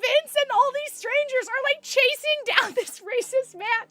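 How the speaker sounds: high, laugh-choked telling; can barely get it out for laughing. Real, not acted. Laughing while telling the chase.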